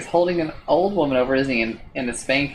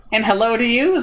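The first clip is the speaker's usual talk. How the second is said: high chirpy little creature voice, a bit louder; chirping creature; 9 semitones higher, 4 dB louder than usual. A narrator's reading. Tiny creature chirps a greeting to the traveler. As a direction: chirp it out like a tiny winged thing.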